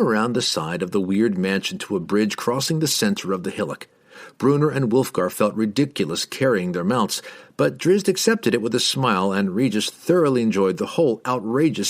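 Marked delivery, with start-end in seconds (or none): none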